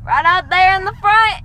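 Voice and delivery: put-on Southern accent. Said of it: "Southern accent".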